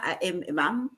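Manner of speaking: ordinary talk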